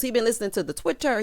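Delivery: ordinary talk, nothing marked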